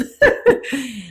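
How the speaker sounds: quick amused laugh at her own joke; short laugh. Real, not acted. Teacher laughing at her own heart joke, short chuckle. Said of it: "maybe I have a heart problem".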